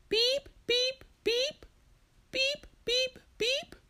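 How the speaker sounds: very high, piercing electronic beeps; imitated beeping. Storyteller voicing the noise Marcia hears, high beeping. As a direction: mimic an alarm in groups of three.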